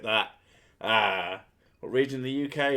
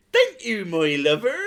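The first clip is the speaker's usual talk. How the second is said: loud, high Cornish thank-you; imitated broad Cornish, pitched up; Cornish accent; 9 semitones higher, 7 dB louder than usual. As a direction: ham up a Cornish shop woman. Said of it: "the Cornish".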